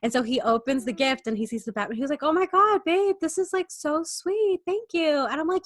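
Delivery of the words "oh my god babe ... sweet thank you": put-on gushing boyfriend. Boyfriend voiced in retold story.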